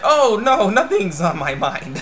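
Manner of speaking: high, loud start sinking into a slow denial; hammed-up cover-up; feigned innocence. Boy denying what preoccupies him.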